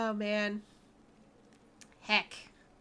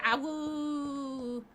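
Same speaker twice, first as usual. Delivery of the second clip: wolf voice; 2 semitones higher than usual. Pretend. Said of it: "That's a sound that vampires make".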